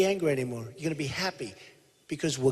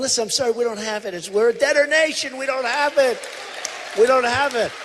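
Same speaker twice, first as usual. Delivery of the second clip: high, raised-pitch insistent refusal; emphatic acted refusal; 7 semitones higher than usual. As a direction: push back hard, pitched well up. Acted refusal to Iran negotiators.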